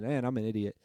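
usual speaking voice

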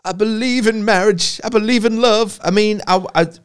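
hammed-up revival preacher proclaiming belief; mock preacher.

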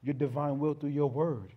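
earnest pulpit prayer, slowing on key words. Acted. Pastor praying over the congregation, earnest prayer. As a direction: pray over the congregation, building then softening.

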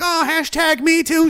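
high, slow, sneering whine; mock the offended people; mocking whiner. Mocking critics of the army with a high sneering whine. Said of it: "now there's people like".

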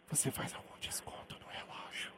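barely audible prank whisper. Pretend. Prank caller to the seller, a near-silent whisper. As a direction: whisper it so he can't hear. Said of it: "prank whisper".

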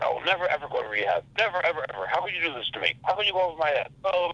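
high, heated protest imitating a friend; upset friend refusing rehab, voiced as a high heated protest; mock angry yell. Retold friend's angry refusal, pitched high. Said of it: "Fucking yelling at me about it".

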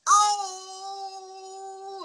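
long high-pitched howl of pain; drawn-out, very high wail; loud howl. Retold or imitated. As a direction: re-enact yelling out in agony. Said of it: "as loud as I could".

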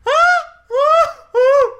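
shrill screeching mock laugh, very high and loud; Green Goblin cackle. Imitating the annoying laugher at the movie with a cackle. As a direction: cackle like a villain pretending to be a woman. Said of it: "sounded like the Green Goblin in that scene".

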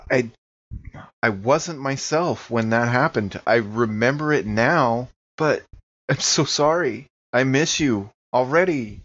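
ordinary talk, nothing marked

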